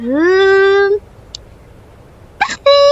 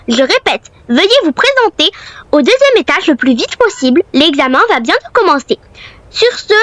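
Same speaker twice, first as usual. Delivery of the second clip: school PA announcement. Acted. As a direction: announce it over the school speakers. School headmistress announcement over PA.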